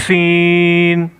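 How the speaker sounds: سین held very long with a whistling hiss. Shown as a demonstration. Teacher showing pupils the whistling letter, slow. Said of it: "باریک پڑھیں گے".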